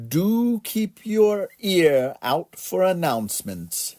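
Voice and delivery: high, slow clerk's voice; station clerk. A narrator's reading. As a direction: stretch it out slowly, pitched high. Station clerk advises departing passengers.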